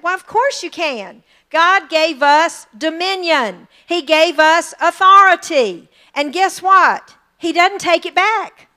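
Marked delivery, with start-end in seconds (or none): 1.5-8.7 slow, emphatic, higher and louder preaching; full-force preaching. Acted. Pastor preaching authority to the congregation, emphatic and louder. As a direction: declare each word like a verdict.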